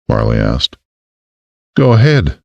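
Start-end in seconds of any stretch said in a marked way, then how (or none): 1.8-2.4 cheerful light Southern twang; beaming Southern. A narrator's reading. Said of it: "Casey beamed".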